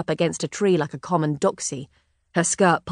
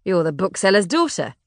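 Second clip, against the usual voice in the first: cheerful. A narrator's reading. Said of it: "he said cheerfully".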